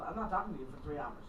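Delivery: low, quick and soft, dry as a rule; quiet deadpan. Shown as a demonstration. Stating her phone rule low and quick to a listener. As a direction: rattle it off low like a house rule. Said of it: "It's called".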